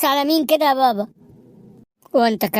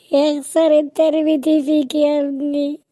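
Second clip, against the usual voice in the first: mock crying; 4 semitones higher, slower than usual. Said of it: "بطل عياط بقى".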